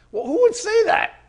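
high, loud disbelieving squeak; high astonished rhetorical question; incredulous. Real, not acted. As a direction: sound amazed anyone would ask.

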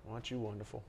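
very soft, dry sarcasm; sarcastic. Real, not acted. Preacher teasing a show-off with soft sarcasm. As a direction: mutter the praise without meaning it.

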